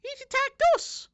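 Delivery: high, slow squeaky rabbit indignation; defensive rabbit voice. Acted. Rabbit justifying the attack on the farmer. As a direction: plead the rabbits' case.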